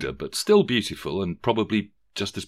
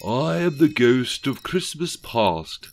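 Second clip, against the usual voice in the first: slow, higher, solemn ghost voice; ghostly Thatcher; 6 semitones higher, 4 dB louder, slower than usual. A narrator's reading. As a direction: announce yourself slowly as an imperious ghost. Ghost announces herself.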